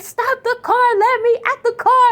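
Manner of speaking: very high, urgent mock screaming at the driver; high pitched retold panic; frantic plea. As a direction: beg her to stop, panicked.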